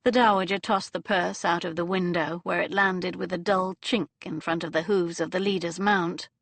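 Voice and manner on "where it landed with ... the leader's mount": usual speaking voice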